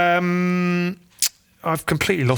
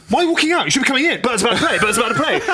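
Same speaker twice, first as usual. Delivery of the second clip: bossy dad; 8 semitones higher, faster than usual. Retold or imitated. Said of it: "went up to Matt and said".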